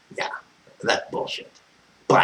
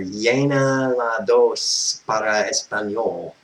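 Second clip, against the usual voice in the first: Spanish menu voice; slower than usual. Retold or imitated. Imitating phone menu Spanish option.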